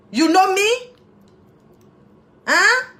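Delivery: goofing around, teasing; silly playful. Pretend. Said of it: "j'arrête de faire ma fofolle".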